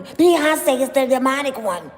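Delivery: loud, high mock-angry accusation; hammed-up angry outburst on the name; Jag getting angry. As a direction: burst out loud, imitating a furious accuser. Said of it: "She gets angry when she says certain people's names".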